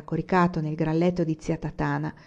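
plain reading voice

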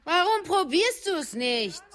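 high, slow coaxing; coaxing mother. A narrator's reading. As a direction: nudge him patiently. Mutter fragt Sohn. (hoch und langsam lockend)

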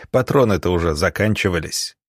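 plain reading voice

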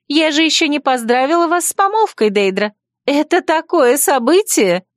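put-on high, sweet chirping girl; cartoon princess. A narrator's reading. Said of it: "прощебетала Микаэла".

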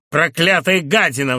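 loud shriek of hatred; a patient's loud, furious shriek. A narrator's reading. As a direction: screech it at the doctor. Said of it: "взвизгнул он".

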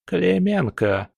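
put-on slow, creaky old woman; creaky crone voice. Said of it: "проскрипела она".

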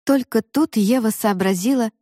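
ordinary delivery, nothing marked

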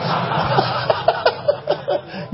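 high breathless wheeze of a laugh; real high-pitched breathy giggle; wheezy laugh. Real, not acted. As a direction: let a squeaky silent laugh burst out. Bursts out laughing.

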